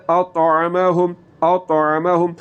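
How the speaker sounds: اطعمہم said slowly, ain from mid-throat. Shown as a demonstration. Tajweed teacher shows students اطعمہم, ain from the throat. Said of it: "عین کی آواز کو آپ نے حلق کے درمیان والے حصے سے نکالنا ہے".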